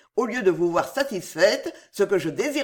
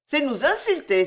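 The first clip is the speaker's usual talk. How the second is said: higher-pitched offended cry; mildly indignant outburst; exclaimed affront; 6 semitones higher than usual. A narrator's reading. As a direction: cry out as if insulted. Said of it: "s'écria Héloïse".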